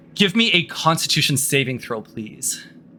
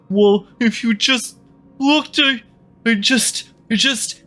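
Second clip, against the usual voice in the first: high, halting panic; hyperventilating; 8 semitones higher than usual. Acted. Darien to the queen, panicked hyperventilating. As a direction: stammer high in short gasped bursts. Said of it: "Darian's gonna start to hyperventilate".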